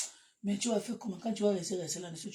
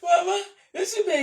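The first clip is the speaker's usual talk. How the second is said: laughing boast; 11 semitones higher, 14 dB louder than usual. Real, not acted. Boasting to viewers while laughing.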